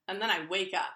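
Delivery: usual speaking voice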